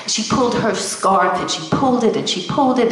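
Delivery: ordinary delivery, nothing marked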